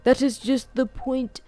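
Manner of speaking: put-on high, eager old man voice; an excited old scholar lecturing. A narrator's reading.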